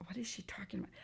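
hushed aside to herself; whispered puzzlement. Retold or imitated. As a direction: whisper it as a baffled private thought. Puzzled inner thought voiced in retelling.

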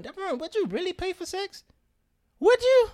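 shrill-pitched mock interrogation; mock incredulous self. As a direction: grill yourself in disbelief.

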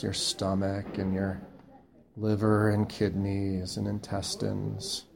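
slow, soft body-scan voice with long pauses; guided meditation. Acted. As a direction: guide them gently through the body, softly and unhurriedly.

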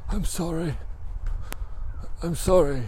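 high, feeble patient's voice; acted weak apology. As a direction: imitate a drained patient struggling to apologise. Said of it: "he hasn't got much energy".

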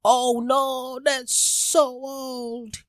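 high, very slow quoted voice. Retold or imitated. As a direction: lift it high and stretch it out. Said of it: "[name] had to come up and say".

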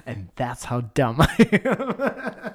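laughing. Real, not acted. Laughing at own story.

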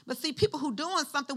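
ordinary talk

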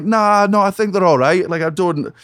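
uneasy, high-pitched backing out; acted brush-off. Brushing off a stranger's rant.

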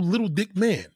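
ordinary delivery

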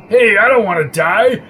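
whiny voice. A narrator's reading. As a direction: whine it like a coward. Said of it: "said Billy in a whiny voice".

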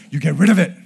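sudden loud, high command; stern outburst. Retold or imitated. Dad ordering daughter off group texts, mock-stern shout. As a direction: burst out loudly, playing the exasperated dad for laughs.

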